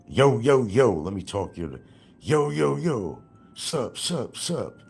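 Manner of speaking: high, loud mocking homie greeting; hammed-up street slang voice; mock street talk. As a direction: imitate a street guy calling out yo. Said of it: "is that how you talk?".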